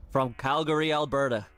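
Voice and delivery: slow, rising arena introduction; boxing ring announcer. Acted.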